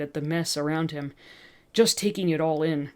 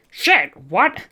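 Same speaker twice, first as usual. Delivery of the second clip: startled; 3 semitones higher, 8 dB louder than usual. Acted. Shopkeeper startled by the bite.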